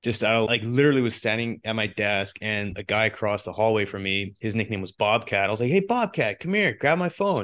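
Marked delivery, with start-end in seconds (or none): 5.7-7.4 calling out. Retold or imitated. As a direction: call a coworker from across the hall. Calling coworker across hallway.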